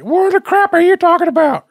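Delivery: high, loud mocking squeal; imitating Donna. Mocking co-host Donna's reaction, high and loud. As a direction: squeal it like a shocked friend. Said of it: "And that's how she sounds".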